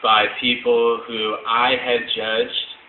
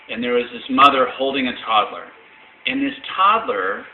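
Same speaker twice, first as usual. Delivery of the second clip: ordinary talk, nothing marked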